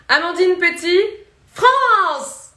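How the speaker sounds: loud, high, proud shout of her name and country; pageant self-introduction. Shown as a demonstration. Miss France showing viewers her Miss Universe introduction, loud and proud. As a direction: announce yourself to the pageant crowd. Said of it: "on voulait m'entendre dire ma présentation à Miss Univers".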